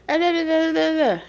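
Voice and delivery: put-on high childish babble; babbling child. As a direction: babble like a little kid. Kids babbling to themselves.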